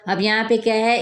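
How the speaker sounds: ordinary talk, nothing marked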